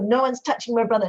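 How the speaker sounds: guard him like a fierce big sister; fierce protective. Retold or imitated. Voicing sister fiercely protecting brother.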